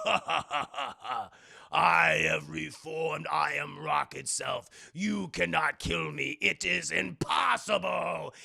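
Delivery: slow, hammed-up villain boast; evil laugh into a slow, grand proclamation; gloating villain. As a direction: boom slowly like a rock monster, gloating. Acts reformed rock villain's boast.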